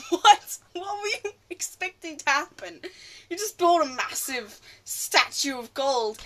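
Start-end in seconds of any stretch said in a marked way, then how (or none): 0.1-3.6 high, loud incredulous laugh-out "What"; laughing disbelief. Real, not acted. Fan scoffing at the gold statue scene, loud laughing disbelief.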